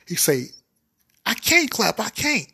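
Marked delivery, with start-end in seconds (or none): 1.3-2.5 high whiny protest. Acted. Tim protesting helplessly.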